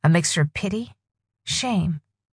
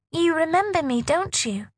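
put-on young girl voice; 10 semitones higher than usual. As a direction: speak as a frightened young girl.

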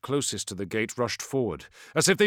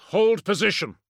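firm battlefield command, louder; commanding order; 8 semitones higher, 6 dB louder than usual. Acted. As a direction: call the order to hold the line. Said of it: "he said, hoping he sounded more confident".

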